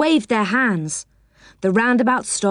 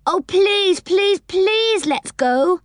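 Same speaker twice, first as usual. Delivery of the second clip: very high, insistent pleading; begging; 11 semitones higher, slower than usual. A narrator's reading. Girl begs to visit the land above, pleading high. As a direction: plead harder on each repeated word. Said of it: "begged Franny".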